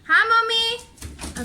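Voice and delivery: high, drawn-out hi; sing-song greeting. Real, not acted. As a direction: stretch the greeting up high and slow. Greeting as she comes back.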